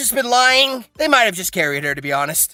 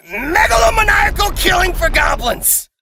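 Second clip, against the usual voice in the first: exasperated shout; 9 semitones higher, 5 dB louder than usual. Real, not acted. Finally gets the word out.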